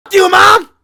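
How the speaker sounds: high, very loud mock yell; imitating bratty kids. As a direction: scream it like a defiant kid mouthing off. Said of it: "y'all kids saying".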